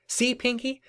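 high, insistent cartoon voice; triumphant Twilight. Twilight proving their loathing to Pinkie, high triumphant insistence.

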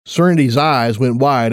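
ordinary delivery

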